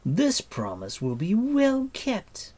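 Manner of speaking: high, loud, vengeful queen; imperious curse climbing in pitch and force; furious cry. A narrator's reading. Queen vowing her prisoner will beg for death, furious cry. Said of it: "cried Artwin".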